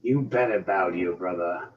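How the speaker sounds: a touch of a smug big brother; lightly voiced smirking threat; quiet smug taunt to his sibling. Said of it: "He just smirks, going".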